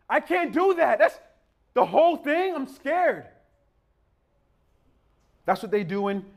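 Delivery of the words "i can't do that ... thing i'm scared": put-on high, loud wail of an overwhelmed kid; hopeless child. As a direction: protest like a child who feels beaten by the task.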